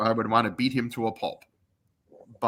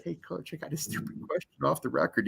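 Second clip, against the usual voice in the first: hushed aside, much softer; retold whisper; 3 semitones higher, 8 dB quieter than usual. Broadcaster confiding off the record to his coach, hushed. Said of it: "I whispered to Bo".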